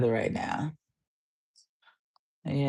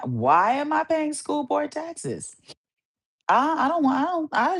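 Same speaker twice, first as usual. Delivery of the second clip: exasperated; 8 semitones higher than usual. Shown as a demonstration.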